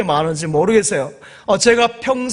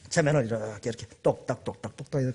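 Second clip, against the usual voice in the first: lower, softer hypnotic murmur; low hushed ticking; 10 semitones lower, 10 dB quieter, faster than usual. Retold or imitated. Pastor miming a hypnotist ticking, low and hushed. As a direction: lull like a swinging watch.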